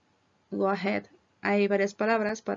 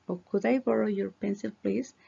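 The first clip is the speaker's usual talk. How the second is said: ordinary talk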